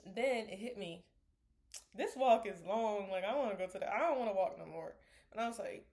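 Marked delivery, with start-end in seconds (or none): none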